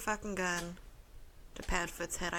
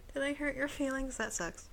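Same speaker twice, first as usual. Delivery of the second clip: sarcastic; 5 semitones higher, 6 dB quieter than usual. Acted. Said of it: "that would be sarcasm if it ever came out".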